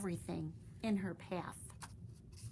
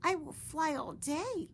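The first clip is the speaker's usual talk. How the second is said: high, small determined voice; a little bat promising herself; high, childlike vow; young bat resolving; 9 semitones higher, 4 dB louder than usual. Acted. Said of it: "Stellaluna told herself".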